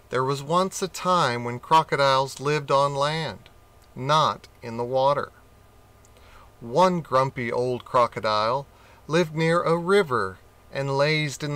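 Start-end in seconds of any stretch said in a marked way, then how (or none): none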